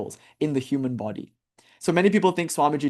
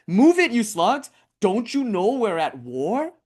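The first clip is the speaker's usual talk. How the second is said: orc shouting; 7 semitones higher, slower than usual. Retold or imitated. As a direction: drive them on like a cruel orc overseer. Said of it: "the orc is like".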